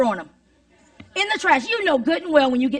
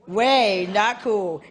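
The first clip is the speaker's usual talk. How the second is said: slow, stretched WAY, dropping lower; drawn-out catchphrase; 4 semitones lower, much slower than usual. Shown as a demonstration. Speaker answers her own question to the teachers, slowly drawn out. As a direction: draw each word out long.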